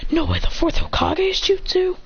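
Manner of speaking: high-pitched astonished question; acted disbelief. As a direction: gasp it out as a shocked foe. Character's shocked recognition.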